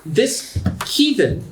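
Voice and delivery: slow, high-pitched disdain; [name]. Acted. Offended seller accusing the goblin, high and disdainful. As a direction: spit out the accusation in a high haughty voice. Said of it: "you can hear [name] go".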